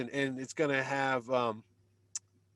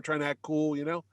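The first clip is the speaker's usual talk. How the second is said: normal talking voice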